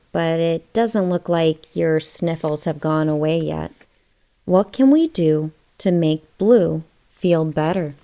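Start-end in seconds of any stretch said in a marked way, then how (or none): none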